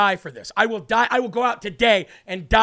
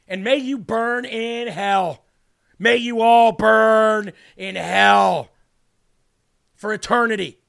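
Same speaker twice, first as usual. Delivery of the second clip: laying a curse; 4 dB louder, slower than usual. Real, not acted. Damning the hosts after shouting.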